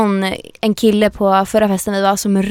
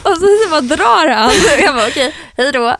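laughing; 6 semitones higher than usual. Real, not acted. Laughing while retelling.

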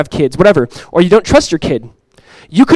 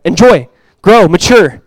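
ordinary delivery

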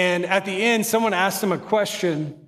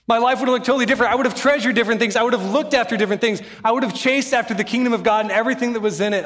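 fast, higher and louder, pouring it out; rapid fervent preaching; 5 semitones higher, 3 dB louder, faster than usual. Acted. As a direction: rush the regrets out at full force. Preacher builds fervent climax.

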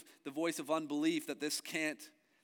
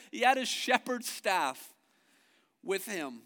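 normal talking voice

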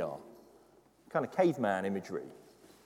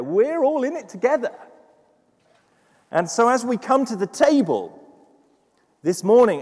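louder, higher, driving sermon voice; preaching at full force; 8 semitones higher, 7 dB louder than usual. Acted. Pastor urging the congregation on prayer, full force preaching. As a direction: preach it hard to the back row.

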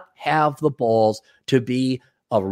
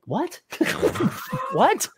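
much higher, softer, laughing confusion; baffled and amused; retold disbelief; 5 dB quieter than usual. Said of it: "I'm like".

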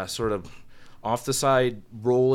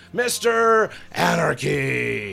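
slow, stretched and louder; drawn-out ring announcer call; 4 semitones higher, 4 dB louder, much slower than usual. Retold or imitated. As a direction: draw the name out like a ring announcer. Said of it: "then I'd rip off Mister Anderson with".

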